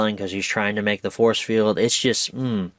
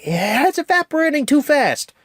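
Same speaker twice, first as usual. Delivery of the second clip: much higher, louder strained shout; Frozone straining; 3 dB louder than usual. Retold or imitated. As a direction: yell it over the fire, struggling. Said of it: "Frozone's like".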